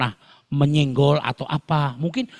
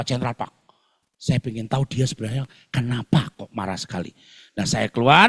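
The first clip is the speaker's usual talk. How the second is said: ordinary talk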